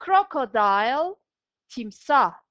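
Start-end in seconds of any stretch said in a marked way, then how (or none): 0.1-1.2 Crocodile said slowly and loud for pupils to copy; drawn-out teacher model of Crocodile; slow repeat-after-me. Shown as a demonstration. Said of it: "Arkamdan telaffuz ederseniz".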